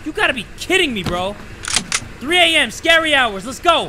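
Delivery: loud, high, exasperated hype; fired-up, impatient hollering; urgent shouting. Real, not acted. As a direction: yell to get things moving. Berating slowness, psyching up.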